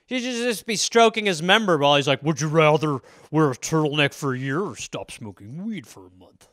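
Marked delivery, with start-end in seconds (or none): 2.2-6.5 low, sinister devil voice; Satan. Acted. Satan gloats over his arbitrary question, low and devilish. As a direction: sink into a soft, low Satan voice. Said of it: "Satan is behind this question".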